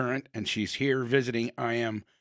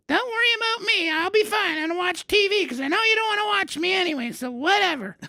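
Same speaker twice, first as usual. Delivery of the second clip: much higher, fast, put-on old-lady voice sulking; grandma's guilt trip. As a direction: play the hurt grandma laying on guilt. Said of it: "I hear her go".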